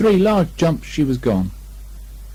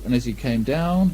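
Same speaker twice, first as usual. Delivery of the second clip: ordinary delivery, nothing marked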